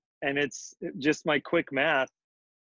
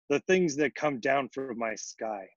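ordinary delivery, nothing marked

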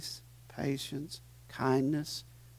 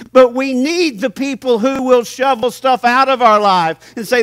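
full-force preaching; 8 semitones higher, 10 dB louder than usual. Acted. Pastor urging his church to accept correction, loud preaching. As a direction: preach it hard to the congregation.

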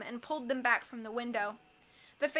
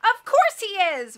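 high, loud, drawn-out cry; loud, emphatic outburst; exasperated cry; 8 semitones higher, 10 dB louder, slower than usual. A narrator's reading. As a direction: cry it out slowly, stretching the words. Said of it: "Violet cried".

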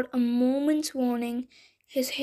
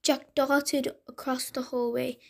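plain reading voice

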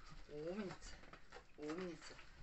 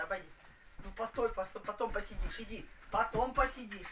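ordinary delivery

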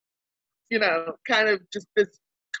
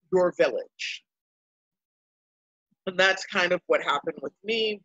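ordinary talk, nothing marked

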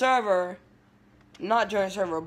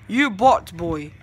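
usual speaking voice

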